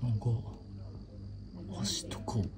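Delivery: soft whisper. Real, not acted. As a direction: hush so the bird stays calm. Whispering near the bird.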